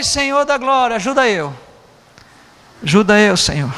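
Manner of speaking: a touch of desperate pleading to God; mock-plead for help, half serious; pleading prayer. Pastor retelling his panicked prayer, mild pleading. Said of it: "Eu falei".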